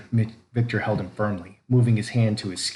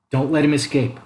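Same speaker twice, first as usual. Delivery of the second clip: urgent order; 6 semitones higher, 5 dB louder than usual. A narrator's reading. Father orders wife urgently.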